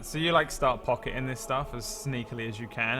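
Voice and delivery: ordinary talk, nothing marked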